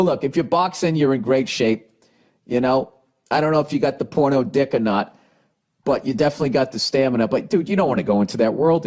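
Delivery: ordinary talk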